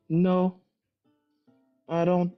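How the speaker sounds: ordinary delivery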